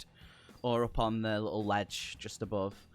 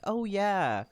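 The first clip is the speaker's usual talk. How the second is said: high, put-on polite agreement; politely pretending; 10 semitones higher, 3 dB louder than usual. Barmaid pretends to know the name J J, politely pretending. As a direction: feign recognition in a small woman's raised voice. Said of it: "she just kind of politely nods".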